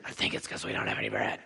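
low, breathy dopey whisper; dim-witted whisper. Pretend. Preacher mocking the clueless disciples, dopey whisper.

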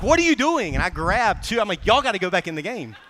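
a loud, high shout at his buddy; retold yell. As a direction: bark the order across the bench. Yells at his coaching buddy.

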